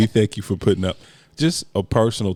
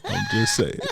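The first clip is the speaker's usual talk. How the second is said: squeaky laugh through the words; cracking up mid-line. Real, not acted.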